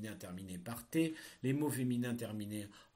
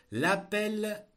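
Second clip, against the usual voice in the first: dictating; 10 semitones higher, 8 dB louder than usual. Shown as a demonstration. Teacher dictating a text.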